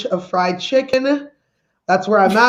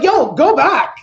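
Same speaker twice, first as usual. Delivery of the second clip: higher, slightly raised call to stop; mock urgent protest; 5 semitones higher, 4 dB louder, slower than usual. Comic objecting to moving up stages, mock protest. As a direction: call them back in comic alarm.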